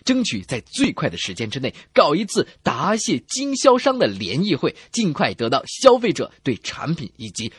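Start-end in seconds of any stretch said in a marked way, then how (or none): none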